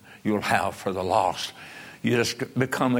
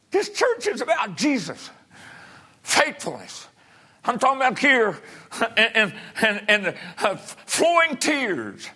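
high-pitched pulpit cry; impassioned preaching; 12 semitones higher than usual. Real, not acted. Preacher tells the church about Jesus and tears, crying out impassioned. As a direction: preach it hard, voice pushed way up.

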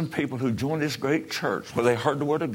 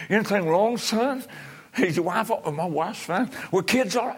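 high-pitched, anxious questions, lightly acted; worried mother; 9 semitones higher than usual. Mother questions her son on the late call, acted worry. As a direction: check on your son, pitched well up.

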